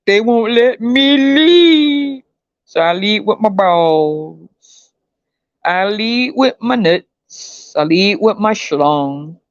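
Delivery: slow, drawn-out dopey voice; mocking dumb man. Mocking a man who leads with his body, slow dopey drawl. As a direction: drag it out like a fool.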